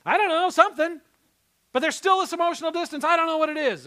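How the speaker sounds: high, loud self-righteous outburst; indignant inner rant. Retold or imitated. Pastor voicing his own resentful heart, high and loud. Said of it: "I'm like".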